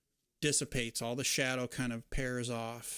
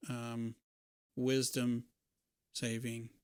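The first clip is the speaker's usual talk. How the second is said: ordinary delivery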